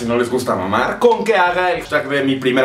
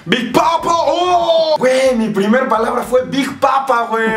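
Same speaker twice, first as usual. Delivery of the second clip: excited shout; 9 semitones higher, slower than usual. Real, not acted. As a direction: shout it with glee. Excited shouting over a joke.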